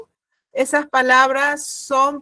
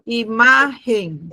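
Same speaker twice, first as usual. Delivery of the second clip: stretched-out syllables, unhurried; slow dictation; much slower than usual. Shown as a demonstration. Teacher echoing a student's word, slow and drawn out. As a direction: say it slowly so each syllable is heard.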